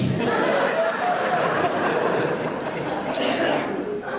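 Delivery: breathy high-pitched laughter. Real, not acted. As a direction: laugh at the punchline. Laughing at the punchline.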